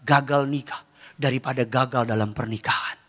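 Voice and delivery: low, soft consolation; God's calm reply. Acted. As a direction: reassure quietly from low in the voice. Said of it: "Tuhan bilang". (Tuhan menghibur dengan suara rendah dan tenang)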